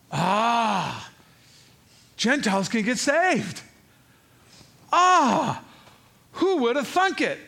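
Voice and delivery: loud, high gasps of amazement; hammed-up astonishment; amazed outburst. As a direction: act out astonished onlookers, stretching the ahs. Acting out astonished witnesses of Gentile conversion.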